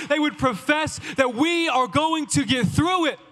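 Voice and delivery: building preacher's drive; rally them, rising; fervent youth sermon build; preaching. Acted.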